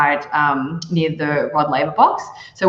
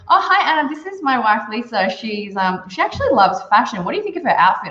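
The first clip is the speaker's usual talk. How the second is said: high, hammed-up cheery husband voice; mock husband; 6 semitones higher than usual. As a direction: play the oblivious husband brightly. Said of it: "And then he goes".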